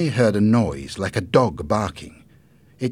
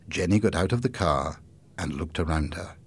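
ordinary delivery

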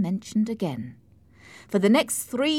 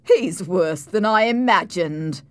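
scornful; 6 dB louder than usual. A narrator's reading. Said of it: "with a sarcastic laugh".

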